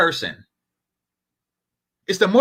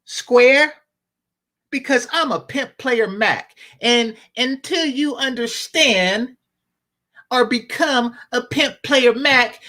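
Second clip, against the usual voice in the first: higher, smug and condescending; arrogant pimp; 5 semitones higher than usual. Retold or imitated. Arrogant pimp brushes off advice, higher and smug. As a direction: lecture the square from on high. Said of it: "he'll say something like".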